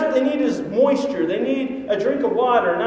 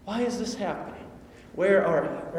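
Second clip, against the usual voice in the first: softer, lower, weary plea; quiet complaint; 4 semitones lower, 8 dB quieter than usual. Retold or imitated. Preacher voicing his own complaint to God, soft and weary. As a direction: sigh it out to God under your breath. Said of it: "I might complain".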